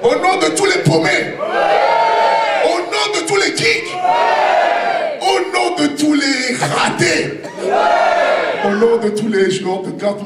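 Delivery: very high shouted rally. Acted. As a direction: rouse the crowd, shouting. Rallying crowd.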